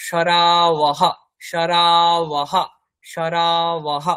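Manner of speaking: সরাওহ dragged out very slowly, ব as w and a breathy -ah; semivowel w. Shown as a demonstration. Teacher modelling the Sanskrit word for bowl, very slow. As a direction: stretch each syllable, w glide in the middle. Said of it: "অন্তঃস্থ ব".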